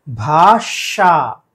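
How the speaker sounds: भाषा very slow, with sh at the end: bhaa-shaa; correct bhasha. Shown as a demonstration. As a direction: draw out भाषा, hissing the sh. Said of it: "ये सही उच्चारण है".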